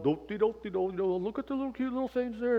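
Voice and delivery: high, fast carefree humming along; oblivious singsong. Pretend. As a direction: play the clueless hiker cooing at cubs. Oblivious hiker between bear cubs.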